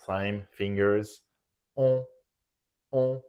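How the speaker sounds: rounded nasal "on" pushed out loud. Shown as a demonstration. Teacher demonstrating round nasal "on". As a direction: round the lips for "on". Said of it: "It should be round".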